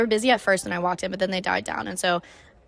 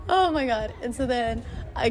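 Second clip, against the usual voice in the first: laughing; 3 semitones higher than usual. Real, not acted. Laughing at own story.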